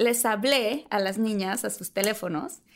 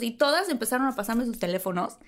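usual speaking voice

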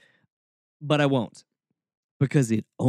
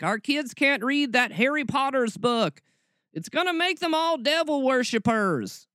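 high, whiny mock of a scandalized parent; hammed-up moral panic, pitched way up; 11 semitones higher than usual. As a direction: imitate an outraged churchgoer in a high voice.

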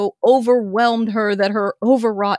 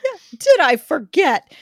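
laughing; 9 semitones higher, 4 dB louder than usual. Acted. Laughing dramatic reading.

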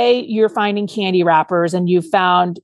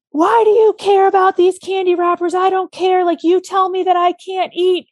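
put-on upset kid; 10 semitones higher than usual. Child lashing out when a parent asks about candy, high and defensive. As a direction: play the defensive child flying off the handle. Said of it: "your kid flies off the hook".

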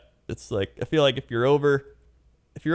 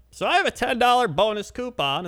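high customer voice; 12 semitones higher than usual. Retold or imitated. Imitating customer.

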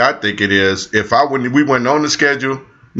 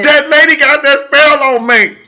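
put-on old person voice; 11 semitones higher, 5 dB louder than usual. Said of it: "sounding like an old person".

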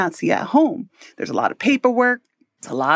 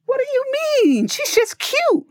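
very high-pitched, incredulous comeback; indignant defensive protest. Retold or imitated. Parent defending a child's speech to their partner, high indignant protest. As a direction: squeal it in mock disbelief.

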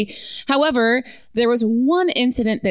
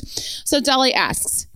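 ordinary talk

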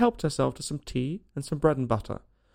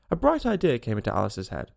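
ordinary delivery, nothing marked

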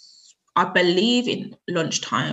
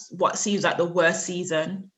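ordinary delivery

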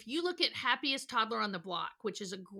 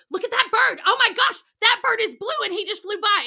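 much higher, louder bright wonder; hammed-up thrilled adult; mock excitement; 9 semitones higher, 11 dB louder than usual. Shown as a demonstration. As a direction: sell the bird to distract a toddler. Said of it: "You can go".

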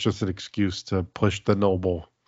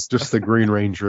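ordinary talk

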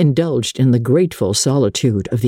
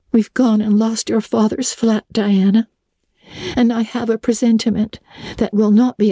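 lightly voiced young girl; 10 semitones higher than usual. A narrator's reading. Anne voiced higher.

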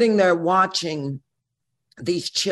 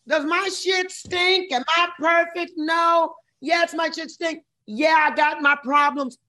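playful high; 10 semitones higher than usual. Real, not acted. Playful self-questioning.

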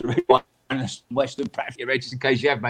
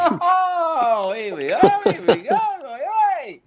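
high, louder sing-song "here we go"; mock greeting; 13 semitones higher, 4 dB louder, slower than usual. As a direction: tease him with a knowing hello. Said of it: "So I've gone".

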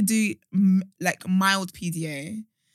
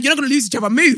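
louder, higher, telling them to move; loud annoyed command; 4 semitones higher, 7 dB louder, much faster than usual. Retold or imitated. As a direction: bark it at a dawdling couple. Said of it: "I'm like".

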